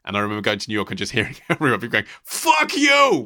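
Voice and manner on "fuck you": loud, high yell imitating street abuse; mock-furious shout, pitched way up; swearing New Yorker. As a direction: hurl it like a brash New Yorker across the road. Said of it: "hearing everyone swearing at each other".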